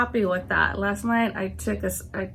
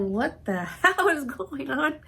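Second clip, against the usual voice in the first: laughing disbelief; 5 semitones higher, slower than usual. Retold or imitated. Retelling her confusion at dinner.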